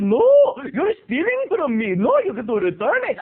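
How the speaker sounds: high, indignant mock Indian accent. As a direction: accuse in a put-on Indian accent. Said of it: "giving this guy an Indian accent".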